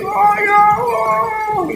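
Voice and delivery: high, slow, wailing cry to God; mock shrieking prayer; screaming prayer. Shown as a demonstration. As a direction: wail it out at the top of the voice. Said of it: "Mau jerit-jerit".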